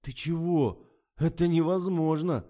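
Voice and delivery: loud, high indignant outburst. A narrator's reading. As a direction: burst out in outrage. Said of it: "Фатима возмутилась".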